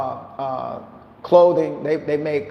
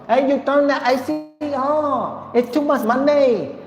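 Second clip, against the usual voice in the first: put-on much higher voice with a Vietnamese accent, ending in a laugh; Vietnamese nail salon accent; 10 semitones higher than usual. Imitating a nail salon worker complaining about cost, high Vietnamese accent. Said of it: "I gotta talk like a Vietnamese at the nail salon".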